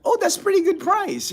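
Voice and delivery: high-pitched, easily impressed newcomer; imitating naive expats. Imitating Americans who just got here, high and impressed. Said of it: "a lot of times they're like".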